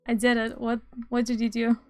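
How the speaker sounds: ordinary talk, nothing marked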